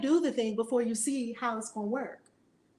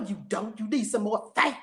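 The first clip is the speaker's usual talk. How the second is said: usual speaking voice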